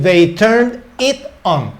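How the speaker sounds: slow, clear example; slow teaching read. Shown as a demonstration. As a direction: model the phrase slowly for learners. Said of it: "puedo decir". (ejemplo dicho despacio y claro)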